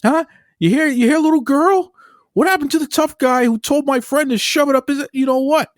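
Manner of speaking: high, strained mob taunt; Pesci mob rant. Retold or imitated. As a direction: taunt him like a hothead gangster in a high, pushed voice. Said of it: "then Pesci says".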